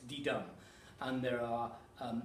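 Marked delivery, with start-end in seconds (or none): 0.1-0.4 weak then strong beat, di-dum; di-dum rhythm. Shown as a demonstration. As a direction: stress the dum in di-dum. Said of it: "a weak stress and a strong stress".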